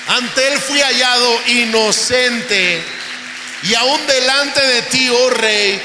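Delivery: proclaiming Daniel. Acted. Daniel to King Darius from the pit, loud and firm. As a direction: proclaim innocence to the king with force. Preaching at full force.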